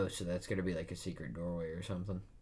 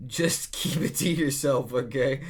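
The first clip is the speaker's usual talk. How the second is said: louder, higher, laughing through the line; laughing plea; 6 semitones higher, 9 dB louder than usual. Acted. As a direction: giggle while begging them to hush. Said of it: "says Nami".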